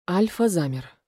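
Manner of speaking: plain reading voice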